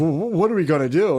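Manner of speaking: stammering high mock panic; flustered lady. Pump lady asking the uncle what to do, stammering mock panic.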